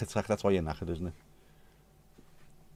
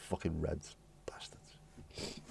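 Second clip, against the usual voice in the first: whisper; 4 semitones lower, 16 dB quieter, much slower than usual. Real, not acted. Muttered curse after naming red.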